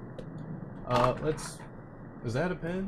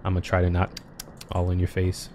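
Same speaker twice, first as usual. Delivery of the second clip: talking around gum; words with gum in mouth; chewing gum; 5 semitones lower, 6 dB quieter than usual. Real, not acted. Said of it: "so I put gum in my mouth".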